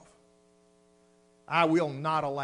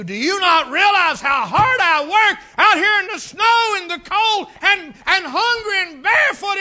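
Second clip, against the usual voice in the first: shouting, much higher and louder, building to a roar; retold husband's explosive tirade, yelling; furious rant; 12 semitones higher, 16 dB louder than usual. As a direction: blow up at your young wife over the money. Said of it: "I went".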